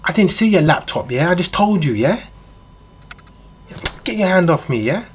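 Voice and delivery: high, indignant mock-female protest; mock angry shouting. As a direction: act a woman aggressively warning someone off. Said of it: "she starts shouting".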